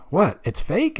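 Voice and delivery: high, startled "what, it's fake?"; kid's shocked disbelief. Recalling childhood, a kid discovering wrestling is fake, shocked. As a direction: gasp it out like a kid learning wrestling is staged. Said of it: "you remember that feeling of".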